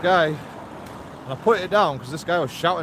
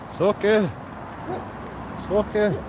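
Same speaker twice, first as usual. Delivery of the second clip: higher, gentle it's okay to a dog; soothing dog talk; 5 semitones higher than usual. Real, not acted. To a nervous dog, higher soothing pet voice.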